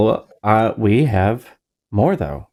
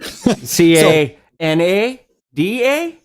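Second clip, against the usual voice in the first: slow teasing spell-out; 5 semitones higher, much slower than usual. Acted.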